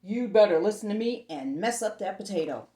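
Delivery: loud menacing warning; warning monster. Acted. Mud monster warning the girl to mess up her potato, loud. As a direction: warn her hard and loud. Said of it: "the mud monster warned".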